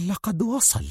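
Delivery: softly breathing "وصل" with eager excitement; excited murmur. Acted. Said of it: "فانتفض جسد سونيا من فرط الانفعال وغمغمت". (غمغمة منفعلة)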